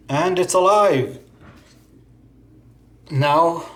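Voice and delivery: ordinary talk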